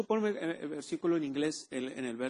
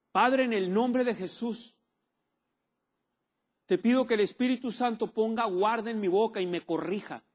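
model prayer; 6 semitones higher, 4 dB louder than usual. Acted.

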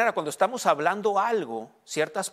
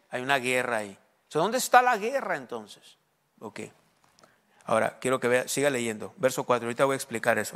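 normal talking voice

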